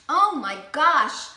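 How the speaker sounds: slow, loud delighted gasp. Real, not acted. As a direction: savor it out loud. Tastes the chili, delighted.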